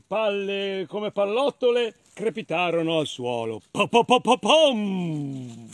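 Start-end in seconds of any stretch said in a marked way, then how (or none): 3.7-5.7 loud popping run, last one drawn out; heavy thudding drops. Acted. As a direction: boom the final thud. Droppings hitting the ground.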